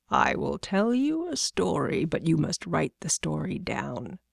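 a god quietly dictating a tale; soft, swooping voice of a god; Raven. Acted.

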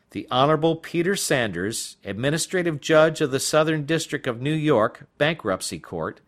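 usual speaking voice

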